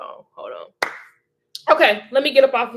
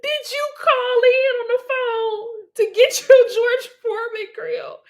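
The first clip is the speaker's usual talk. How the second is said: very high question broken by laughter; crying with laughter; 4 dB louder than usual. Real, not acted. Teasing Andrea about phoning in for a grill, crying with laughter. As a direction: squeal it out, helpless with real laughter. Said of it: "Oh, I'm crying. I'm laughing so hard".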